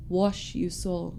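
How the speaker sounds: deep solemn voice. Retold or imitated. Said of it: "he'd just look at you and be like".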